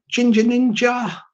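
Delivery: ordinary delivery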